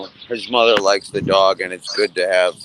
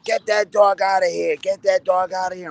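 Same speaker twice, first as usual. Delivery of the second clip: lightly voiced grandma, pitched up, telling the dog to go; a hint of a cranky grandma ordering the dog out; grandma shooing the dog; 8 semitones higher than usual. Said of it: "she's like".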